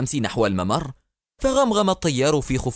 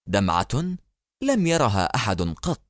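usual speaking voice

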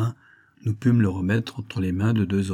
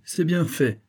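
high, exclaimed satisfaction; exclamation satisfaite; 7 semitones higher, slower than usual. A narrator's reading. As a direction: say it out with the pitch raised. Valet is taunted, then repeated lower. (exclamation satisfaite, voix haute)

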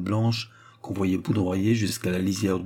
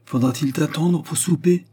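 higher-pitched, mildly called question; calling out; 7 semitones higher, 3 dB louder than usual. A narrator's reading. Sorette to departing Antoine, lightly calling out. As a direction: call after someone who is leaving. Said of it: "lui cria Sorette".

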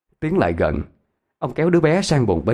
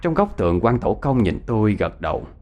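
plain reading voice

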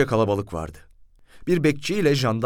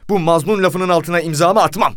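high, loud protest, building; outraged complainant digging in; indignant refusal; 7 semitones higher, 7 dB louder than usual. A narrator's reading. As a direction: refuse outright in a raised voice. Outraged narrator refuses to sign.

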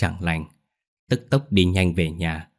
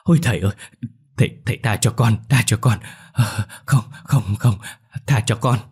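higher, frantic begging in short bursts; terrified screaming plea; 3 semitones higher than usual. A narrator's reading. Crazed sorcerer begging master for mercy, terrified. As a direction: beg for mercy in panicked cries. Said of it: "chắp tay gào thét".

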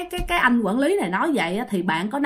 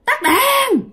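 sudden high shrill cry of lights off; possessed shriek; 13 semitones higher, 4 dB louder than usual. Retold or imitated. Possessed girl shrieks at the card table to kill the lights. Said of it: "nó mới la làng lên".

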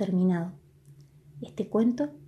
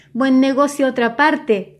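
loud, high, still laughing; laughing through tears; 4 semitones higher, 8 dB louder than usual. A narrator's reading. Bartolo mocking the salesman while laughing. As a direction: wipe away tears of laughter as you say it. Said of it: "secándose las lágrimas de risa".

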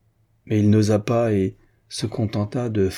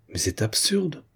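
a hint of bothered exasperation, pitched up and held back; annoyed; 7 semitones higher, 4 dB quieter than usual. A narrator's reading. Marquis dismissing the banker's accusation, mildly annoyed. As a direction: brush it off, put out and a bit embarrassed. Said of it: "dit Morière embêté".

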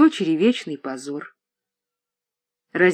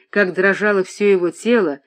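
plain reading voice

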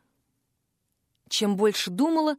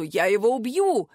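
pirate threat. A narrator's reading.

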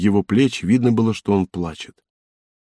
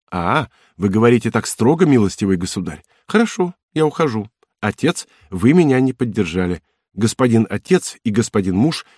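Cosette, playful. Acted. Cosette's mock-offended speech.

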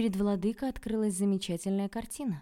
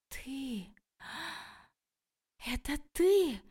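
shocked gasp; 8 semitones higher, much slower than usual. A narrator's reading. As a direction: exhale it in shocked disbelief. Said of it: "потрясённо выдохнула Окладникова".

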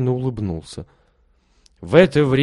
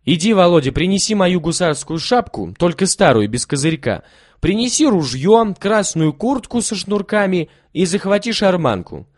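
put-on higher, louder commanding boy; bossy orders; 8 semitones higher, 5 dB louder than usual. A narrator's reading. Kolya orders Volodya to fetch the props, loud and bossy. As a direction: rattle off orders.

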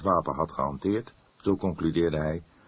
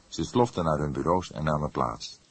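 ordinary delivery, nothing marked

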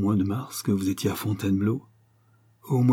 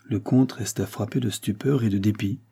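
ordinary delivery

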